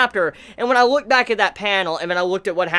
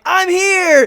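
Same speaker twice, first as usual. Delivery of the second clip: loud, high triumphant holler; big mock-heroic entrance shout; Luffy's shout; 10 semitones higher, 6 dB louder than usual. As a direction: burst in yelling to announce yourself. Luffy announces himself in retold panel.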